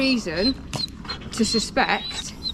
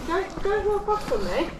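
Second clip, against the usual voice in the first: high, tense, urgent command; alarmed officer ordering him to stay; scared and angry; 10 semitones higher, slower than usual. Real, not acted. Officer orders man not to leave.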